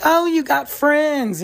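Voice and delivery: high, slow, airy mock-mom voice; a hammed-up clueless mom. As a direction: parody an oblivious mom cooing. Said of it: "And the mom's like".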